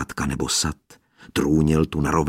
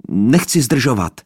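high, loud call upward; 6 semitones higher, 8 dB louder than usual. A narrator's reading. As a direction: call up to someone unseen. Boy calling up to balcony. (chlapec volá nahoru k balkónu, hlasitě)

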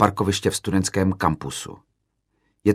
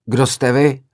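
higher, louder angry challenge; raised-voice, heated retort; heated yell; 6 semitones higher, 6 dB louder, slower than usual. A narrator's reading. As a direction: fire it back at the hall. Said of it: "zařval jsem rozpáleně do sálu".

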